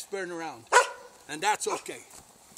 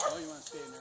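much higher, soft talk to a dog; 8 dB quieter, much faster than usual. Real, not acted. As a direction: coo at the dog.

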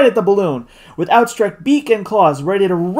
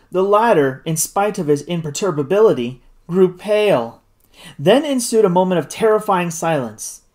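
usual speaking voice

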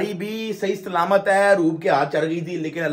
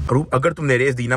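ordinary delivery

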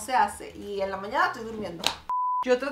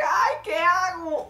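whiny panic; 6 semitones higher, slower than usual. Retold or imitated. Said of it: "uno como que".

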